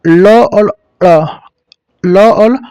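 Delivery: drawn-out ल और ड़, slow and loud, the tongue curled back; sound between la and ड़. Shown as a demonstration. Teacher shows students the sound between la and ड़, slow and loud. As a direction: sound ल और ड़ slowly to show the in-between sound. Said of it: "इसका जो उच्चारण होता है ना".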